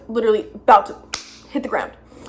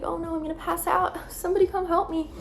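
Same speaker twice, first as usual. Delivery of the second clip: higher, mocking damsel-in-distress whine; mock attention grab; 8 semitones higher, 5 dB quieter than usual. Mocking herself faking faintness for attention on set. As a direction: ham up fake helplessness for attention. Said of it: "an attention grab where I was just trying to get attention".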